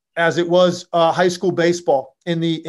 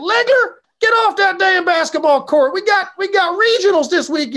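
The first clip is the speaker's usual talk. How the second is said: put-on southern accent; 12 semitones higher than usual. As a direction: holler it like a Southern baseball coach. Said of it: "he had a southern accent".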